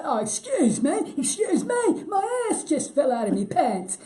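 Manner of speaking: high, loud imitation of a comic old-timer; second old character. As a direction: top the other old character, drawn-out and high. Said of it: "And then she'd say".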